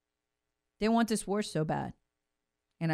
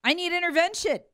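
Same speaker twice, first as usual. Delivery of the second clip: mock bully crying victim; 7 semitones higher than usual.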